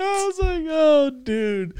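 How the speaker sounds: high, laughing words; real laughter under the quote. Real, not acted. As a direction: talk through the giggles. Still laughing, retelling.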